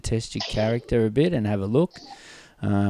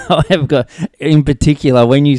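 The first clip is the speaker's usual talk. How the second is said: higher, louder, laughing with real excitement; goosebumps excitement; 6 semitones higher, 8 dB louder than usual. Real, not acted. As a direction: burst out, thrilled by the memory. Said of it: "Mate, I have just got goosebumps".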